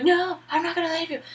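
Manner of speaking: high, mock heroic protest; put-on pleading high voice; melodramatic refusal. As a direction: refuse in hammed-up anguish. Acted melodramatic refusal to wounded Meryl.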